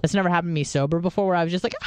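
ordinary talk

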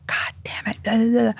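hushed, imitated cursing fit; muted mock rant trailing into nonsense syllables; mock temper. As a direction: sputter under the breath like a hot-tempered boss. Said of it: "Italian temper".

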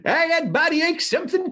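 high mock character voice; put-on podcast voice. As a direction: play along in the squeaky voice. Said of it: "just did it that way".

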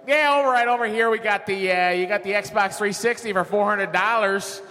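mock Chicago salesman with gum in his mouth; high, loose Chicago huckster voice; Chicago accent. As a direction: chew the words like a Chicago pitchman. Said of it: "talking like I was from Chicago".

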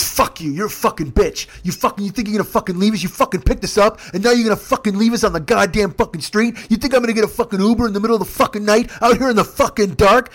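high, loud tirade as the furious passenger; retold outraged rant. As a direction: rant in disbelief, hammering the swear words.

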